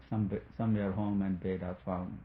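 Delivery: ordinary talk, nothing marked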